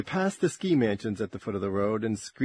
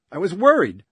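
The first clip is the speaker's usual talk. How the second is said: loud; 6 semitones higher, 12 dB louder than usual. Acted. Jill's angry worried outburst.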